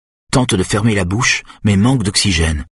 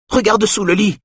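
high-pitched, lightly voiced terror; a hint of panic in a raised call; terrified cry; 12 semitones higher than usual. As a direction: sister calls out to her brother, pitched up and scared. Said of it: "d'une voix terrifiée".

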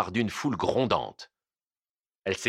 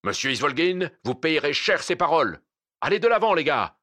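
raised, threatening warning; menacing exclamation; 5 semitones higher, 4 dB louder than usual. Acted. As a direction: warn him, then bark the order higher. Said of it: "s'exclama menaçant le brigadier".

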